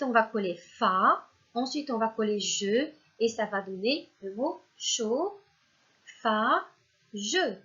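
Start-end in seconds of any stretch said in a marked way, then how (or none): 4.8-7.5 CHAUD, FA, JE as three separated syllables; word by syllables. Shown as a demonstration. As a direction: sound out CHAUD, FA, JE with pauses. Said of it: "commencer avec la syllabe chaud". (« chaud, fa, je », syllabes bien séparées)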